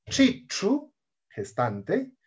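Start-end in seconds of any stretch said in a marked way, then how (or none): 0.1-0.9 "Chichu" with both ch sounds retroflex; high-pitched "Chichu", tongue curled back on each ch. Shown as a demonstration. Teacher modelling the new letter, retroflex ch.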